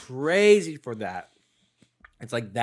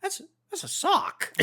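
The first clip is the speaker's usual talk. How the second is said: parrot voice. Retold or imitated. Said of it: "it's like".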